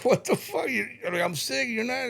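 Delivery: high-pitched incredulous protest; retold indignation. Sick dad retelling his protest at home, indignant and high.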